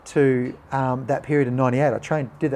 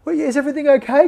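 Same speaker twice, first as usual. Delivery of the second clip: concerned parents; 13 semitones higher, 6 dB louder, faster than usual. Retold or imitated. Parents worried on phone, retold.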